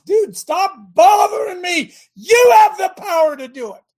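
exasperated shout. Acted. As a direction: bellow it like an annoyed father fed up. God voiced, exasperated, preaching.

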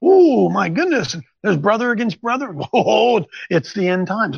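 hammed-up end-times fretting; mock alarmed believer. Preacher imitating people who see prophecy everywhere, mock alarm. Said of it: "take that scripture and say".

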